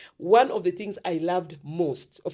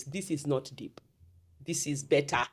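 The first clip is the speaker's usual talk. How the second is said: deep voice demo; 4 semitones lower, 8 dB quieter than usual. Shown as a demonstration. Guest to host, showing her childhood deep voice, lower and softer. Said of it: "I had a very deep voice".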